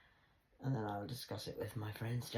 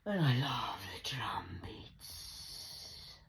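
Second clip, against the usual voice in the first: slow whisper; 6 semitones lower, much slower than usual. Real, not acted. Savouring the drum beats, slow whisper. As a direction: draw it out in a whisper.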